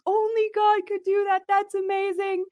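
high, amazed gushing listener voice; mock amazed. Host voicing viewers in awe, high gushing amazement. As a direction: gush it like an awed fan.

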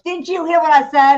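a bully losing patience; bully snapping. Retold or imitated. Bully snaps at the kid.

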